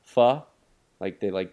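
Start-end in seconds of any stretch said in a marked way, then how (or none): 0.0-0.4 "pho" said with no R, loud and open; mock Boston "for" as "pho"; dropped R. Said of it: "don't do the actual R on it".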